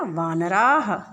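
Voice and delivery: वानराः drawn out, long final aa; slow model answer. Shown as a demonstration. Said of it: "तो क्या हो जाएगा?".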